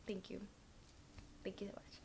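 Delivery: a soft breathy whisper of thanks; whispered heartfelt thank you. Real, not acted. Fading thanks for 5K subscribers.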